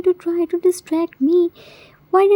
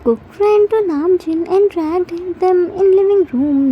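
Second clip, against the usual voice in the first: normal talking voice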